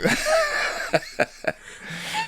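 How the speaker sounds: high laugh. Real, not acted. Laughing at bear joke.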